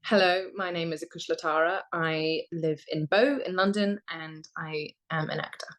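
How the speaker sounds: put-on general English accent; generic British accent. Shown as a demonstration. Actor showing the host their generic British accent on request. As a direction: introduce yourself as if British. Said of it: "pretending that you're British".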